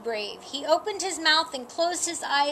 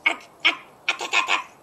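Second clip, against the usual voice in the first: cat chirping; 6 semitones higher, 5 dB louder, faster than usual. Acted. Kitten chirping at birds.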